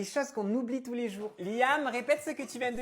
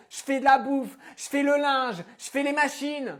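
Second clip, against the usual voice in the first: melodramatic angry mother; 4 semitones higher, 7 dB louder than usual. Acted. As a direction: rant like a wounded martyr mum.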